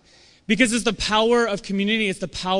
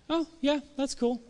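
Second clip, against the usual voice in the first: a high, soft, indifferent huh yeah that's cool; unimpressed; 7 semitones higher, 6 dB quieter than usual. Retold or imitated. Friend shrugging off your big news, indifferent. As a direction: brush it off like it's nothing.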